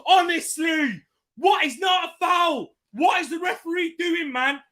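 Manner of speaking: high, loud outraged yelling at the ref; furious fan shouting at the telly; angry shouting. Real, not acted. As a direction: yell at the referee in disbelief. Ranting at referee during match.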